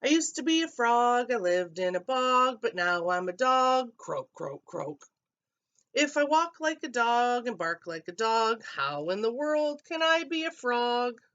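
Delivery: high, loud frog-dog voice; Dudley answering the boys; croaky frog. A narrator's reading. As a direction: croak it back proudly. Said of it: "Dudley croaked back".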